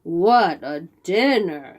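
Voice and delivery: slow, satisfied giant voice; a hungry giant admiring his catch; lightly voiced greedy giant; gloating giant. A narrator's reading. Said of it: "said the giant".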